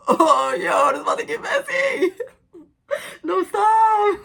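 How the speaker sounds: high, loud laughing reaction. Real, not acted. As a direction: squeal with laughter at the plot. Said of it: "reacting to own story".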